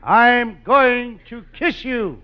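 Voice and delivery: drawn-out, high-pitched mock pass; eager comic threat. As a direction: stretch it out like a giddy suitor. Costello lunges for kiss.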